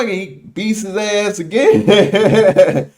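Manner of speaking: drawn out into real laughter; amused. Real, not acted. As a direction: tell it cracking up at the end. Amused by the repeated beating.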